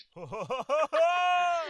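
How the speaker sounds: high, drawn-out burst of laughter; long, high-pitched laugh. Real, not acted. Cohost reacts to the fried-egg joke, high laughter.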